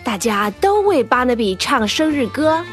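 plain reading voice

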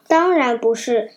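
usual speaking voice

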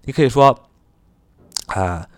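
usual speaking voice

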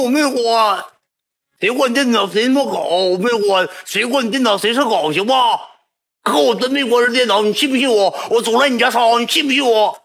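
high, insistent denial; mock protest. Boy denying to the attendant, high and pleading. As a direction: protest your innocence, voice way up.

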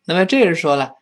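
ordinary talk

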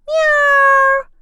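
高而响的喵; 猫愤怒地长叫; angry meow. Acted. Cat yowls. (猫生气地长喵一声)

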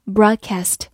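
"broadcast" with an American short "a" as in cat in "cast"; American pronunciation. Shown as a demonstration. Teacher showing learners the alternative, "broadcast" in American pronunciation. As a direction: say "broadcast" the American way, ending "kast" with the vowel of cat. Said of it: "或者美式发音".

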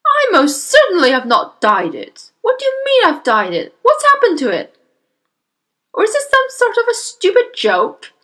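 loud, high, indignant denial; yelling father. Acted. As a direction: protest loudly with rising alarm. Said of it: "the father yelled".

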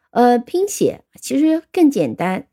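ordinary talk

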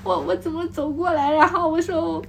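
hoog, klaaglijk nagespeeld snikken; nagespeeld huilen. Retold or imitated. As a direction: speel de huilende reiziger, hoog en zielig. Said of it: "我一面抹鼻涕".

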